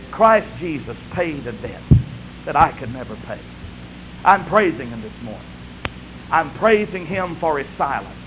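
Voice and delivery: usual speaking voice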